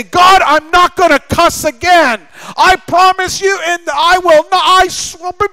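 high-pitched mock pleading promise to God; acted-out solemn promise; earnest vow. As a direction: swear it up high, like someone desperate to be believed. Voicing a believer's vow to God.

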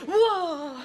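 loud, high laughing whoa; mock whoa. Mimicking the healer's hands-on reaction, mock whoa.

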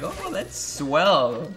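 slow, high mock-proud mom; drawn-out, sweetly impressed mother; imitating mom. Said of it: "my mom will be like".

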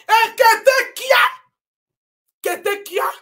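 taunting call; mocking call. Real, not acted. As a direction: call the name out to mock.

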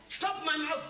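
ordinary talk

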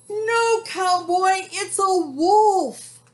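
high, loud wail stretched at the end; panicked cows. A narrator's reading. Cows warn cowboy about the wolf, high loud panic. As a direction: shout the warning in storybook panic.

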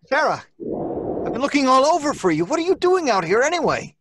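loud, high, hurried call. Acted. Husband calling to his wife after searching for her, loud and hurried. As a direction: call to her across the graveyard, relieved.

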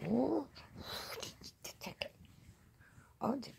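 startled high exclamation; surprised. Real, not acted. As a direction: yelp in surprise. Cat pounces again.

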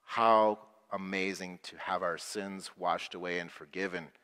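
ordinary talk, nothing marked

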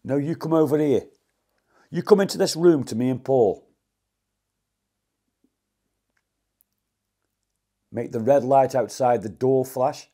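loud, bold challenge to a ghost; calling out loud. Real, not acted. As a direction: call it out to the empty room.

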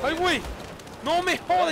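sudden high startled yell; surprised shout. Real, not acted. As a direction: shout in shock. Sorpresa al morir en partida. (grito de sorpresa)

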